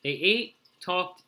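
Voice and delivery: plain reading voice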